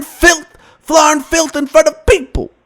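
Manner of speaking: high, sing-song Cosby voice saying "filth, flarn"; lilting, scolding dad voice on "filth"; Bill Cosby impression. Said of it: "That's when Bill got pissed".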